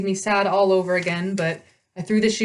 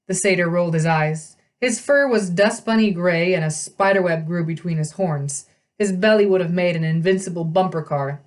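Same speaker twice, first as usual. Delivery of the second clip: ordinary delivery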